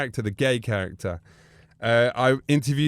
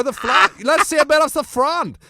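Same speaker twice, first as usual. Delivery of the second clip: much higher coaxing Austrian voice; Bruno voice; 12 semitones higher, 5 dB louder than usual. Retold or imitated. Bruno coaxing the wrestlers, in a high coaxing voice. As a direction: wheedle like a flirty Austrian. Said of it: "I go".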